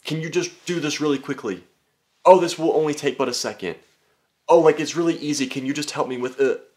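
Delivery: high, pleading mock voice; mocking a needy stranger's ask; needy favor asker. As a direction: whine for a quick favor. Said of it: "people are just like".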